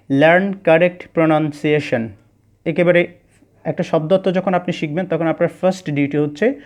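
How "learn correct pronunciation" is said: each word drawn out as it is written; slow dictation. Shown as a demonstration. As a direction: read it slowly like a heading on the board. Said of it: "প্রথমে আমি লিখলাম". (ধীরে ধীরে বোর্ডে লেখার মতো পড়া)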